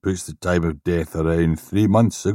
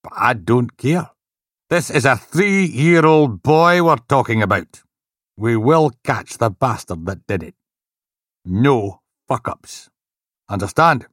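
angry inspector; 5 semitones higher, 5 dB louder than usual. Acted. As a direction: lay down the law angrily. Angry inspector lecturing team.

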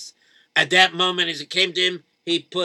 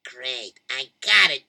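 ordinary delivery

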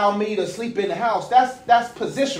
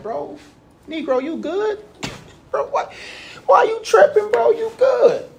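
God calling out; 13 semitones higher than usual. Retold or imitated. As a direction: call out to him like a worried buddy. God voiced, high and loud.

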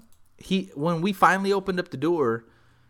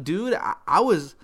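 usual speaking voice

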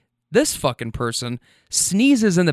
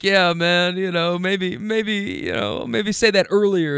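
higher, softened voice of the other man; imitated mild complaint; 4 semitones higher than usual. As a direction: gently gripe in a put-on higher voice. Acting out the man's mild complaint.

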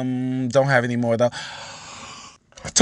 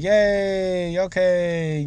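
fake cheerful; 6 semitones higher, much slower than usual. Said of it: "with a smile on my face".

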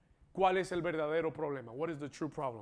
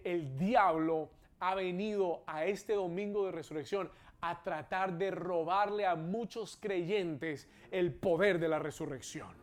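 usual speaking voice

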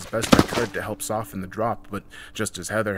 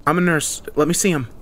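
loud, higher-pitched urgency; raised, insistent voice; urgent assertion; 8 semitones higher, 7 dB louder than usual. A narrator's reading. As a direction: take charge of an emergency. Nurse pushing in to help.

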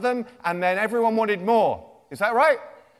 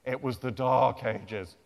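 low, slow, doomy narration; a hint of doom, low and slow; Dark Ages voice; 8 semitones lower, 5 dB quieter, slower than usual. Shown as a demonstration. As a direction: intone it like an old chronicle. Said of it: "that's the Dark Ages sound".

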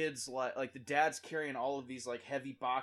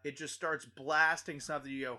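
ordinary talk

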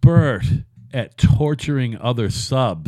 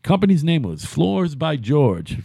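normal talking voice

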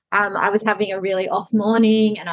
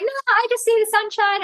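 high, silly toy panda voice; squeaky pretend panda refusing; 14 semitones higher than usual. Mother voicing panda refusing to sleep, high and silly. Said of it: "And I'm like".

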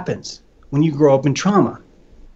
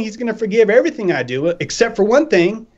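normal talking voice

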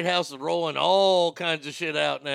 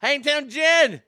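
calling out; 7 semitones higher, 7 dB louder, slower than usual. Real, not acted. Greeting a viewer by name.

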